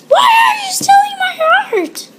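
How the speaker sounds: very high, loud scream with a hammed-up cry; mock wailing scream. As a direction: wail it like a fake tantrum. Screamed crying mock outrage.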